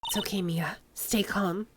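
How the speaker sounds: soft whisper to herself; hushed self-reassurance; inner whisper. Acted. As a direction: whisper it to steady your nerves. Inner thought calming herself.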